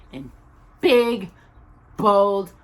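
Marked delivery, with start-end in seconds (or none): none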